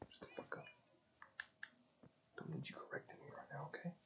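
fast hissed whisper, trying not to be heard; annoyed whispered rebuke; harsh whisper. Real, not acted. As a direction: hiss it under your breath, quick and annoyed. Hushing a voice mid-game.

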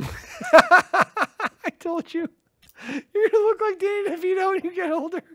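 high, very slow teasing voice. Real, not acted. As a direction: drag it out gleefully. Teasing friend, high laughing.

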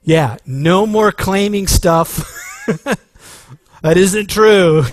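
loud, slow, laughing through the words; laughing exclamation. Real, not acted. Preacher laughing loudly at Peter's lesson. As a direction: laugh it out at full volume.

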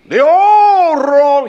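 loud, slow, drawn-out cry of they all wrong; mocking outburst. Preacher voicing the hasty man, slow mocking outburst. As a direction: fling it out as scornful judgment.

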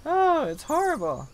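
high, squeaky mock-guilty wail. Gamer to the armadillo, a high mock-guilty squeak. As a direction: whine it out like a guilty little kid. Apologizing to the armadillos she kills.

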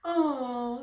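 lightly sobbed oh; a touch of tearful complaint; mock sob. A narrator's reading. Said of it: "sobbed her understudy".

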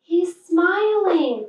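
delighted cry. A narrator's reading. Little Bear sees the snowman smile, delighted cry. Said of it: "cried Little Bear suddenly".

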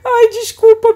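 startled shout. Real, not acted. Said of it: "porque eu gritei".

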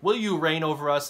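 high, loud pleading trees; trees asking the bramble. Retold or imitated. Trees pleading with the bramble to be king, high and loud. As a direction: beg like a little tree looking for a king. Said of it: "they're like".

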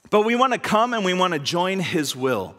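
ordinary talk

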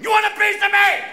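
high, loud taunting shout; hammed-up threatening holler; belligerent challenge. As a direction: yell it like picking a fight. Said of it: "with a baseball bat going".

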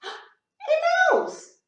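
high gasping delight; gasp, then a thrilled little cry; astonished high exclamation; surprised gasp. Acted. Surprised discovery of another mouse.

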